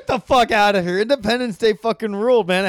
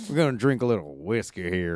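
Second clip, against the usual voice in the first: mock Southern accent; 11 semitones lower, 7 dB quieter than usual. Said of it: "kind of a southern accent".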